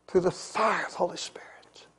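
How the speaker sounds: hushed, breathless words; whisper. Real, not acted. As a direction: drop to a whisper. Hushed sermon line.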